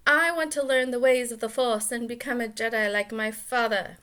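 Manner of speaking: an eager boy voiced with a strange accent; unexplained accent on young Luke; odd accent. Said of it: "I don't know why that's his accent".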